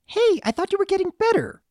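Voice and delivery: high, quick filly accusation; accusing child. A narrator's reading. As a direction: challenge your friend in a little girl's voice. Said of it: "She poked the colt accusingly".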